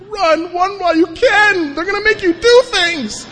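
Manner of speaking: shrieking high, hammed-up alarm, slowed down; mock panicked warning. Speaker mock-warning newcomers away from AA, hammed-up high shriek. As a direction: howl it like a doomsday prophet fleeing the building.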